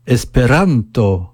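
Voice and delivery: slow Esperanto, RAN drawn out and TO let go reluctantly; long stressed RAN, reluctant ending. Shown as a demonstration. Teacher models the accent for learners, Esperanto with long stressed RAN. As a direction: stretch RAN in Esperanto, give up the last syllable with regret. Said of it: "comme si l'on prononçait à regret la dernière syllabe".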